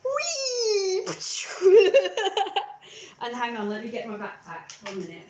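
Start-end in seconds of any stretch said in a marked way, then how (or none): 0.0-1.1 high, gleeful whee; swinging the backpack at someone, gleeful; playful whee. Shown as a demonstration. 1.1-3.2 loud giggling burst; laughing at her own swing; burst of laughter. Real, not acted.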